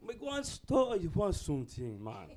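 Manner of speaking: put-on Jamaican accent. Offering to fetch something from the store, Jamaican accent. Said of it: "you say that same sentence in Jamaican, it's way more calming".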